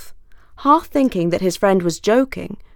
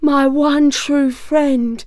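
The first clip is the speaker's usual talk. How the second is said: pitiful wolf voice; 7 semitones higher, 3 dB louder, slower than usual. Acted. Trapped wolf calls up to fox.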